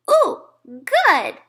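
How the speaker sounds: put-on high, loud little-kid delight; a pleased toddler. A narrator's reading. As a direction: squeal it like a small child. Toddler Fudge voiced tasting.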